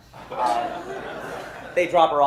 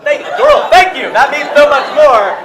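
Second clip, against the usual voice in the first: loud, high mock-grateful outburst; shouted thanks; 14 semitones higher, 19 dB louder than usual. Comic acting out thanks to a girl, shouted. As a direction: gush it out at a shout, much higher.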